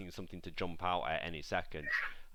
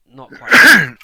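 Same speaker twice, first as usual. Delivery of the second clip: sudden loud end; 7 semitones higher, 26 dB louder than usual. Acted. Barmaid closing line.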